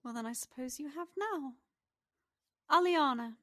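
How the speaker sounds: high, quick pixie voice; pixie character voice. Acted. Game master plays the pixie.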